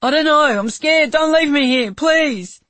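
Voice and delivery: high, loud pleading close to tears; terrified pleading. Acted. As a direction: beg not to be left. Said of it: "The boy burst into tears".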